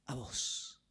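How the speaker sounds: low, near-whispered words of God; hushed tender quote. Retold or imitated. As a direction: let it fall to a soft breath. Voices God's tender words to him.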